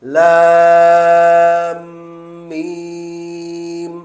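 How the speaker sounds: each name stretched very slow and long; drawn-out letter names. Shown as a demonstration. Teacher stretching the letter names very slowly. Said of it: "তাড়াতাড়ি বলা".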